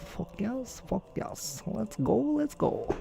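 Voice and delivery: soft, high, breathy cheering; half-whispered victory cheer; hushed celebration. Real, not acted. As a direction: cheer under your breath. Celebrating a record number.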